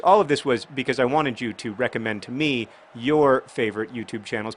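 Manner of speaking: ordinary talk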